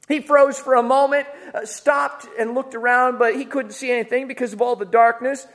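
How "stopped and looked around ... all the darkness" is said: normal talking voice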